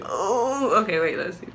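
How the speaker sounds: talking while chewing gum. Real, not acted. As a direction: chew gum as you talk. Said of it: "I got gum in my mouth".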